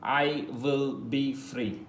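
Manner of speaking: slow, spaced-out English line; slow model sentence. Shown as a demonstration. Teacher slowly modelling the translation. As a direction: drag each word out for the class. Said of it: "So we are using future sample".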